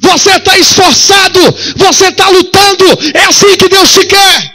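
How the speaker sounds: high, loud, slow preaching cry; preaching at full force. Acted. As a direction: drive each line home to the congregation.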